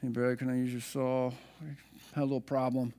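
soft, sheepish voice; sheepish confession. Retold or imitated. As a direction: confess it quietly, a little ashamed. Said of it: "I'm like".

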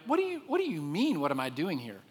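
high-pitched, indignant pushback; acted frustrated complaint; frustrated Elijah. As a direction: argue back in exasperation, pitch up. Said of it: "that's kind of a frustrating question".

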